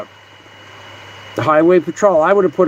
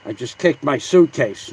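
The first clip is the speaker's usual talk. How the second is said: usual speaking voice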